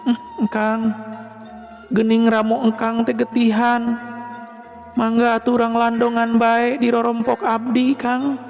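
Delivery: high, sweetly smiling female voice; smiling woman character. A narrator's reading. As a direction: offer help in a high, gentle feminine voice. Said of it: "bari imut ngagelinyu". (sora istri luhur bari imut)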